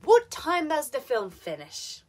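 WHAT high, FINISH falls; falling question intonation. Shown as a demonstration. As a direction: start high and loud, step down to "finish". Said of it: "falling intonation with W H and open".

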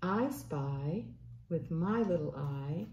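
plain reading voice

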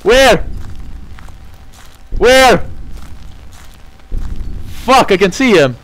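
high, loud frantic yells ending in a scream; searching wildly for the monster in terror; panicked yelling. Real, not acted. Hunted by Slenderman, panicking.

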